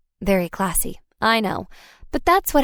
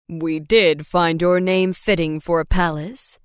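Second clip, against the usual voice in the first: lower, fond father voice; smiling dad; 4 semitones lower than usual. A narrator's reading. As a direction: say it with a smile, fatherly. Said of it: "Dad smiled".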